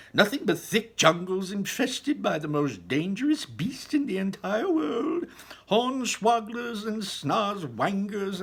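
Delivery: high, loud excited showman telling a tale; Mister Wonka storytelling. Acted. Wonka telling the children about Loompaland, high theatrical voice. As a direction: spin the yarn high and theatrical.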